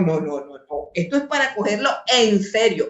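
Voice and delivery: ordinary talk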